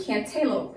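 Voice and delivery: CANTALOUPE said can-TAY-lope; wrong CANTALOUPE, middle syllable rhyming with day; long A mispronunciation. Shown as a demonstration. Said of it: "that would be a long A".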